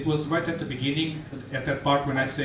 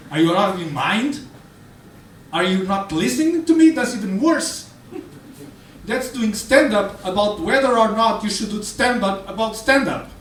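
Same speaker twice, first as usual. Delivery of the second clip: outraged yell; 6 semitones higher, 5 dB louder than usual. Retold or imitated. Friend yells in outrage.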